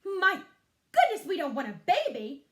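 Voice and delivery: loud, high shocked mother; outraged cry of a mother; alarmed mother. Acted. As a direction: recoil in loud dismay.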